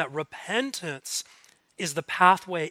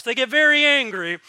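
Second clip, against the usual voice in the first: louder, higher hammed-up anger; mock angry; 6 semitones higher, 8 dB louder than usual. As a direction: punch it out in mock outrage.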